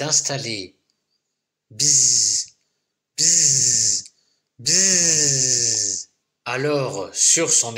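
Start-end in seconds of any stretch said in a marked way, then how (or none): 1.7-6.1 buzzing like a bee. A narrator's reading. Storyteller buzzing for the little bee. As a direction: buzz it out.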